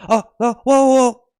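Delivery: high, loud yelps of panic; startled, climbing whoas; mock alarm. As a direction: pretend you're about to fall. Phone slipping again.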